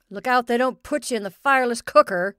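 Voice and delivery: loud teasing call. Acted. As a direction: call out across the yard. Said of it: "he called to the goat".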